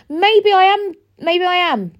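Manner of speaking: high, loud maybe I am; defiant retort. Real, not acted. Answering people who call her emotional, defiant and loud. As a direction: fling it back at them.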